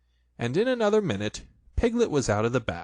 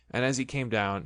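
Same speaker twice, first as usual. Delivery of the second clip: plain reading voice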